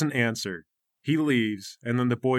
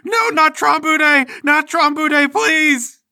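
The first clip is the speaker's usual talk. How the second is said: much higher, loud screaming plea; terrified scream; 12 semitones higher, 7 dB louder, slower than usual. Acted. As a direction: scream and beg, slow on not Trombude. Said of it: "He's screaming".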